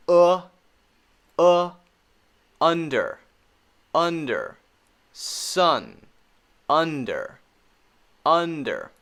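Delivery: careful, separate "under" with loose "uh"; short U demo. Shown as a demonstration. Teacher reviewing short U, deliberate "uh, under, sun". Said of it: "the U sound".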